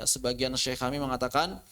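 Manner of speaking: usual speaking voice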